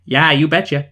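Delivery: loud mock Minnesotan catchphrase; Minnesotan accent. Reading out the film tagline tourists request, loud Minnesotan accent. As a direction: put on a hearty Minnesotan accent. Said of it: "Distinctive Regional Accents".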